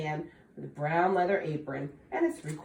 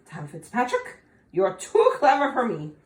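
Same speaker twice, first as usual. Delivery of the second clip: defeated leprechaun; 4 semitones higher, 6 dB louder than usual. Acted. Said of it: "quit squirming and said".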